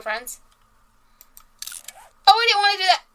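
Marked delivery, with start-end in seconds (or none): 2.3-3.0 loud, high yelp of surprise; startled outburst. Real, not acted. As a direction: blurt it out in alarm. Accidentally added a friend.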